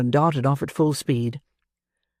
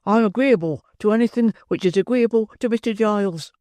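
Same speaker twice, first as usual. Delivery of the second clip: higher, meek, frightened politeness; timid politeness; 7 semitones higher than usual. Acted. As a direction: agree nervously to please him. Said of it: "very pale in the face and very polite".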